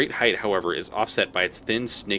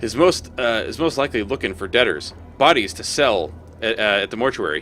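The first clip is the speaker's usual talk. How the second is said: cheery collector; 3 semitones higher, 3 dB louder than usual. Acted. Collector hails the player, chipper and loud. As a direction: greet like a friendly grubby hustler. Voices Molt.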